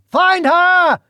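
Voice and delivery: a high, loud command; demand. Acted. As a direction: order your men after her. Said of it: "he demanded".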